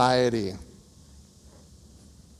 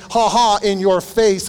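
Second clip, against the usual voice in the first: mock taunting ha ha, raised and louder; mocking gloat; 5 semitones higher, 5 dB louder, slower than usual. Counselor mocking the wrong reply to his wife, taunting. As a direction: gloat at her in jest. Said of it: "kind of thing".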